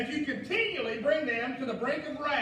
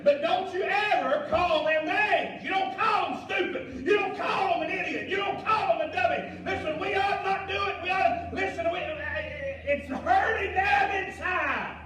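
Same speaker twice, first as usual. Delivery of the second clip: loud, high, impassioned pleading from the pulpit; preaching at full force; 7 semitones higher, 5 dB louder than usual. Real, not acted. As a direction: hammer each warning home, voice raised.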